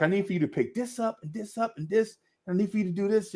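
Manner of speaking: a hint of a bossy lady; lightly voiced woman. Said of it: "Like, giving him instruction".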